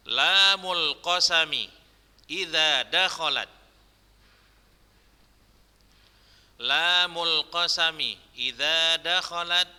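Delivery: measured, repeated dictation; dictation reading. Shown as a demonstration. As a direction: read it out phrase by phrase for students. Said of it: "Ustaz bacakan dulu".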